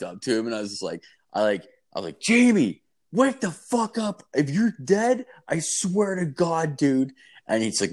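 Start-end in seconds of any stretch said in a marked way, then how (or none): 2.2-7.1 shouting at a roommate who seems dead; panicked yell. Retold or imitated. Friend screaming at roommate to wake up, frantic.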